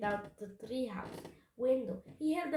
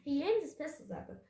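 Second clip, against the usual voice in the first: ordinary delivery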